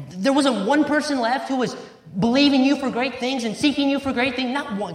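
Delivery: can't believe not one was found; rising amazement, pressing the question again and again; incredulous disbelief. Retold or imitated. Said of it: "My mind says".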